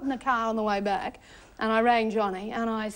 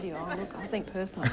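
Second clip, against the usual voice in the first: unimpressed retort; 5 semitones lower, faster than usual. Retold or imitated. Reporter retelling her reply to his crocodile gushing, unimpressed. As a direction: drop the voice for a dry, underwhelmed answer.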